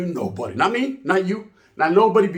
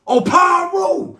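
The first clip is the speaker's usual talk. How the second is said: high, slow shouted call; 12 semitones higher, 5 dB louder, much slower than usual. Retold or imitated. Homeboy calling out to Paru about the tapes, slow high shout. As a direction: stretch it out and shout his name. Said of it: "They said".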